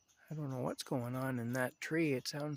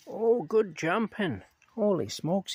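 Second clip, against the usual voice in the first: higher, louder, slow delighted praise; excited praise; 7 semitones higher, 8 dB louder, slower than usual. Real, not acted. Watching cat Rocky leap, delighted higher louder praise. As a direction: cheer the cat's jump. Cheering cat's jump.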